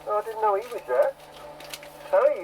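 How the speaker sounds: normal talking voice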